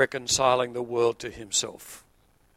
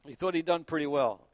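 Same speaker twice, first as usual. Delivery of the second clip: normal talking voice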